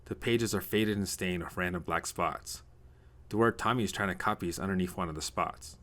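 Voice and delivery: usual speaking voice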